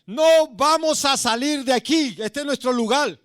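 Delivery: demons' voice. Retold or imitated. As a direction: drag it out like defiant demons. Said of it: "los demonios que estaban ahí me decían".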